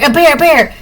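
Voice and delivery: hammed-up panicked call of a bear sighting; mock yell. Hiker spotting a bear, shouting in mock panic. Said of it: "And you start yelling".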